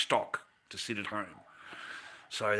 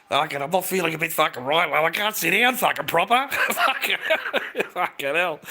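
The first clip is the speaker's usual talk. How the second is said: high, loud comic complaint breaking into laughter; mock alarm; 6 semitones higher, 9 dB louder than usual. Man complaining to imagined bedmate that he's sore, mock alarm. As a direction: yelp it in mock distress, then crack up.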